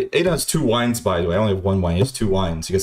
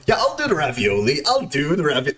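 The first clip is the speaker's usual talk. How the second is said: imitated Aiden; 3 semitones higher than usual. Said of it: "Who fucking speaks like that?".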